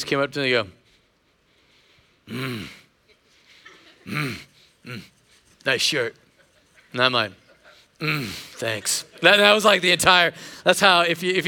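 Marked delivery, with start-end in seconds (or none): 2.3-6.2 low, soft grunting tough guy; military grunt. Retold or imitated. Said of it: "we speak in grunt". 8.0-9.0 low, soft grunted thanks; grunted reply. Retold or imitated. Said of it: "we speak in grunt".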